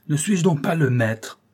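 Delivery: un cri de colère, plus fort, plus aigu; il affirme son autorité en criant; voix terrible. A narrator's reading. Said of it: "s'écria-t-il d'une voix terrible". (colère criée, plus fort et plus aigu)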